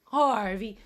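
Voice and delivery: sudden loud call to the dog. Real, not acted. Streamer calling her dog awake. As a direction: call the dog's name out.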